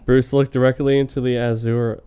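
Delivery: normal talking voice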